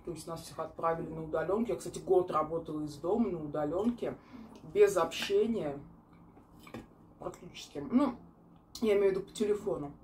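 speaking with food in mouth; words between chews; talking while chewing. Real, not acted. Seen 1: Bite taken at -2; mouth works food while she keeps talking.

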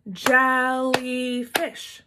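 very slow jel-ly-fish, each beat apart; syllable clapping. Shown as a demonstration. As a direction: stretch jellyfish into three claps. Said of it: "let's clap the syllables in jellyfish".

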